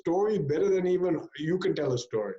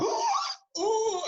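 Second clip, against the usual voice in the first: high-pitched oohs of wonder; excited child. Retold or imitated. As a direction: imitate a child thrilled by the story. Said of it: "the child gets excited".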